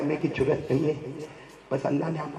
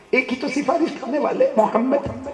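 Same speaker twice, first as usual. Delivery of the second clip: higher, louder preaching cry; rousing sermon; 7 semitones higher, 6 dB louder than usual. Acted. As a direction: push the crowd to answer. Preacher rouses the crowd. (ولولہ انگیز خطابت)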